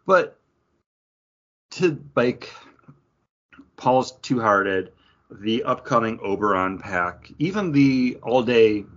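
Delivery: normal talking voice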